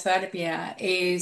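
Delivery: normal talking voice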